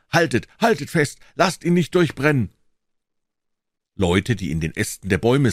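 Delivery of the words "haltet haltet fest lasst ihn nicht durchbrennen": high, agitated crowd cries; urgent calling out. A narrator's reading. Crowd shouting to stop the fleeing suspect, urgent high calls. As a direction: urge the others to grab him.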